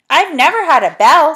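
put-on loud, high old deaf voice; deaf saucepan man. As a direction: boom out the mishearing.

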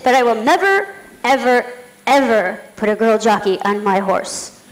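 "never, ever, ever" stressed, the last part slowed; firm refusal. Retold or imitated. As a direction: drag out "ever put a girl jockey" flatly, lightly imitated. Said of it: "He said".

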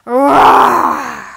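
big roaring shout; loud tiger roar. Shown as a demonstration. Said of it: "Did you roar nice and loud".

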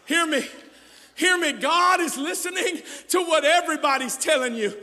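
loud, high shouting sermon; full-force preaching. Acted. Pastor voicing the doubters to the church, full-force preaching. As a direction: preach it out, hammering each line.

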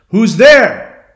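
high, loud challenge into the woods; calling out. Retold or imitated. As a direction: call out to an unseen stranger. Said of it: "he's calling out".

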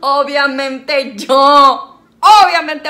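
slow, loud, laughing brag; drawn-out boast. Real, not acted. Mother to viewers, sure she wins: slow loud brag. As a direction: stretch out the victory claim. Boasting she'll win the game.

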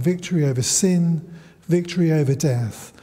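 ordinary delivery

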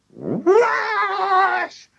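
long, very high squawking shriek; wild rising chicken screech. A narrator's reading. Chicken shoots into the air after the medicine, piercing shriek. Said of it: "shrieked the chicken".

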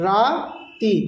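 "রাতি" split as "রা - তি"; teacher sounding out "রাতি" slowly in two parts; broken into syllables. Shown as a demonstration. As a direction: stretch "রা", pause, then "তি". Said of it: "একটু প্রনাউন্সিয়েশনটা আলাদা হবে".

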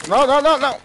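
shouting. Real, not acted. Sudden enemy in game.

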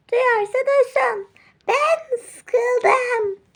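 high, loud, tearful whine; a spoiled girl wailing she's bored; whiny crying child. Acted. Bored daughter whines at mother. (yüksek, ağlamaklı sızlanma)

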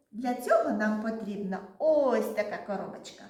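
normal talking voice

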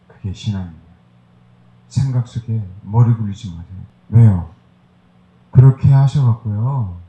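normal talking voice

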